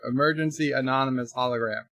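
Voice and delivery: ordinary delivery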